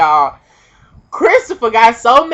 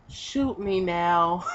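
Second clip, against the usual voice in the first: slow, soft, lifeless; defeated mutter; 3 semitones lower, 12 dB quieter, much slower than usual. Retold or imitated. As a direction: let it drop out in defeat. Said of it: "I'm just like".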